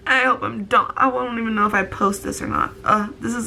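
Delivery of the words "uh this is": ordinary delivery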